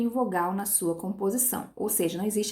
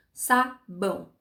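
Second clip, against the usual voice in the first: "sabão" slowly broken as sa, bão; two separate beats in "sabão", sa then bão; split into syllables; much slower than usual. Shown as a demonstration. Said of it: "Duas sílabas".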